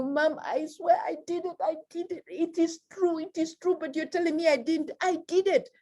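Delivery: put-on high, pleading child voice; an honest kid begging mom to believe; child insisting. Child insisting to mom it is true, high and pleading.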